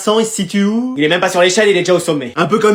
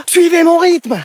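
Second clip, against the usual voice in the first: calling out; 8 semitones higher, slower than usual. Acted. Mother calling out while striding.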